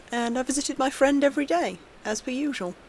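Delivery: plain reading voice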